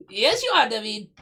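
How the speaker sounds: high, lightly mocked Polish accent. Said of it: "she's Polish, so it was more like".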